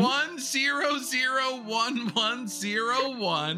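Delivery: high princess voice. Acted. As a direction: keep the sweet princess pitch. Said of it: "Princess voice".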